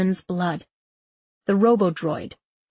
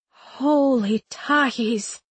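breathless, slow and higher; 4 semitones higher, much slower than usual. Acted. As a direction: gasp it out, winded. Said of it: "she said breathlessly".

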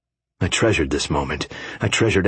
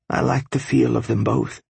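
airy and breathy, a little higher; breathless; 5 semitones higher than usual. A narrator's reading. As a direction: breathe the words out. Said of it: "she said breathlessly".

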